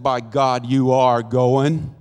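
loud, slow, emphatic insistence; firm, drawn-out retold command; stern mother's order. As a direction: lay down the law, slow and loud.

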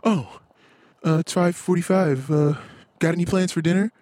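high, sing-song impression of his wife; airily mock her text as if nothing is wrong; wife's text to husband read out in a high mock voice. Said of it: "and text me".

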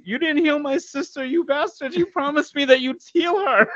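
mock accusation. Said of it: "You're like".

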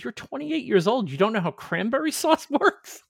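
high-pitched mock disbelief, breaking into a laugh; incredulous ex-wife. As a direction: imitate an ex-wife's astonished, high-pitched challenge. Ex-wife's disbelief at his confession.

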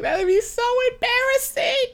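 very high squealed cringe; hammed-up mortified whine; retold mortification. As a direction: squeal it as if dying of embarrassment. Reliving secondhand embarrassment.

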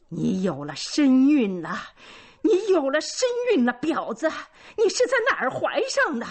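high, loud furious outcry; crying out. A narrator's reading. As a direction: scream the accusation at her daughter. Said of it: "她突然叫道". (母亲朝女儿愤怒地尖叫)

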